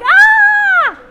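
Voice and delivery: high loud cartoon scream; Home Alone scream. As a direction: scream like the burglar hit by the blowtorch. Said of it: "and he's like".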